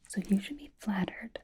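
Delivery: usual speaking voice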